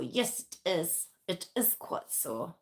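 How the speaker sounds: mock-posh English accent. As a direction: slip into a posh accent for no reason. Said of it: "I don't know why I'm speaking posh".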